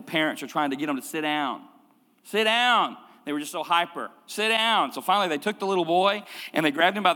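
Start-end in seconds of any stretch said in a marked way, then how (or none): 2.2-3.0 parental command. Retold or imitated. Parents ordering hyper boy. 4.3-4.9 parental command. Retold or imitated. Parents ordering hyper boy.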